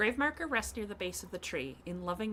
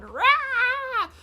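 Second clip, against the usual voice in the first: much higher, louder war cry; screaming; 13 dB louder than usual. Pretend. Streaking across Mistria like a bat, wild yell. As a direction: let out a wild battle yell. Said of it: "screaming as I streak".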